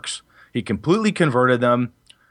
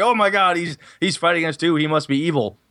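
imitating panicked players; 7 semitones higher than usual.

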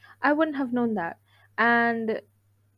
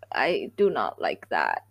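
much lower and slow, drawn out; deep slow voice; 12 semitones lower, slower than usual. Real, not acted. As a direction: drop the voice way down. Disliking hookup culture.

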